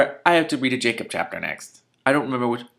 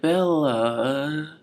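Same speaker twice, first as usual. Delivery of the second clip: higher, half-committed moan; halfway moan; 6 semitones higher than usual. Shown as a demonstration. Mocking how Edward almost moaned, a half moan. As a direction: moan the name but only halfway. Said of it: "So he's, like, halfway there".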